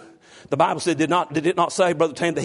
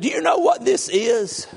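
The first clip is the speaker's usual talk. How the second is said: high, drawn-out demanding question; mock cranky wife; 9 semitones higher, 3 dB louder than usual. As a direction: imitate a cranky wife, slow and pitched up. Retold morning squabble with wife.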